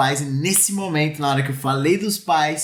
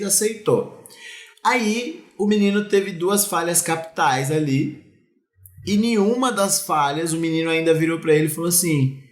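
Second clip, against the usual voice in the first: ordinary talk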